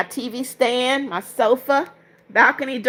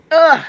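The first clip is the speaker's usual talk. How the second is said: short loud strained grunt; a sudden high, loud ugh of strain; effort grunt; 6 semitones higher, 7 dB louder than usual. Real, not acted. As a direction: grunt with effort as you lift. Effort while moving camera.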